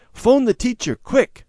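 high, loud urgent call; excited. A narrator's reading. Boy urging mother to call teacher, excited. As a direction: rush it out, thrilled. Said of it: "eyes shining with excitement".

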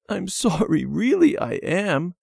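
put-on high, earnest child's plea; pleading apology. A narrator's reading. Debbie apologising to cross Randy, pleading. Crying sister begs forgiveness.

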